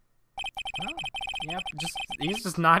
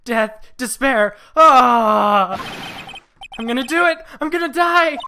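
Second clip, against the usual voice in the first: much higher, loud, slow at first then a shrieking cry; despairing client; 11 semitones higher, 12 dB louder, slower than usual. Acted. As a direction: melodramatic wail of doom. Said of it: "It sounds like he wants to die".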